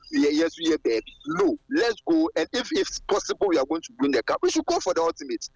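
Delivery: ordinary delivery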